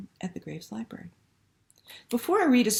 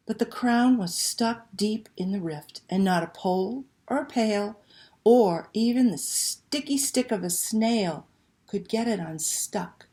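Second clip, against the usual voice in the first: slow, lively picture book reading; reading to children; slower than usual. Acted. Librarian reading Una to children online, expressive storytelling. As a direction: read it like a bedtime story to little ones.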